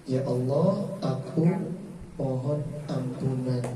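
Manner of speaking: slow, soft, humble prayer; humble plea. Shown as a demonstration. Preacher translating istighfar as a humble soft plea. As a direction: ask forgiveness gently. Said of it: "Artinya apa?".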